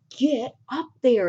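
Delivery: emphatic mock-exasperated push; forceful order. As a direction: act out shoving someone into action.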